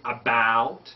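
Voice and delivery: "about" slow and open, British or Australian style. Shown as a demonstration. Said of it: "more of an open sound in British".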